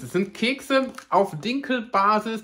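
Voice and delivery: ordinary talk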